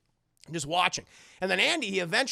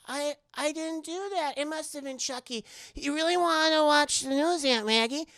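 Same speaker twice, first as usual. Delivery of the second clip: pleading innocence, pitched up; little boy voice; 7 semitones higher than usual. Retold or imitated. Andy denies moving Chucky to Aunt Maggie, higher kid voice.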